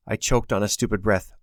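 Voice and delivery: ordinary delivery, nothing marked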